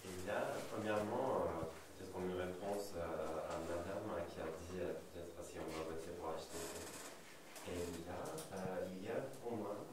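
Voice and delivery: Turkish accent in French; French spoken with a Turkish accent; foreign accent in french. Real, not acted. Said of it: "excusez-moi pour mon français, moi je suis pas français, donc je suis turc".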